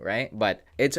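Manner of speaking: ordinary talk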